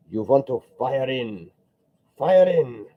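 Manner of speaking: put-on high, loud German-accented bark, building on the repeat; mock German yelling. Art teacher yelling at his students in a German accent. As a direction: yell it like a pushy German painting teacher. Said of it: "be yelling".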